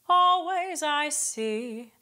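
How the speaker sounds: "always" sung slow with the glottal catch almost gone; "always" with only a hint of a glottal start. Shown as a demonstration. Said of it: "I almost got rid of it there".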